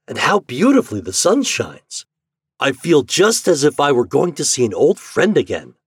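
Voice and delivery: high, lilting delight; bright-hearted storybook enthusiasm; happy father. Acted. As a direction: marvel happily with wide pitch swings. Happy father admires the sun.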